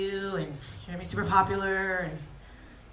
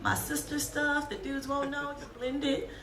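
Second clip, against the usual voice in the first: put-on teen making excuses; 6 semitones higher, slower than usual. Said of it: "You'd be like".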